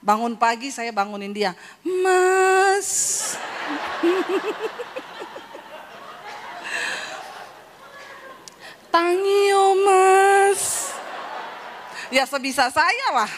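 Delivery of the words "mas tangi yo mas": sweet Javanese wife. Pretend. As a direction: play the refined Solo wife. Waking husband in refined Javanese.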